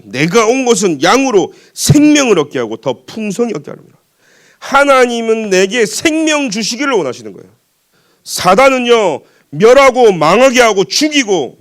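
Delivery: high, loud, forceful preacher's voice; full-force preaching. Real, not acted. Preacher contrasting life from God with Satan's destruction, full-force. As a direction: proclaim scripture with rising force.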